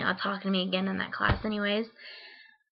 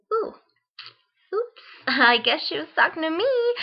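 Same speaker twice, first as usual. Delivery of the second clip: higher, louder, laughing through the words; embarrassed laugh; 7 semitones higher, 4 dB louder than usual. Retold or imitated. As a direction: caught out, giggle it off. Realises teacher was addressing her.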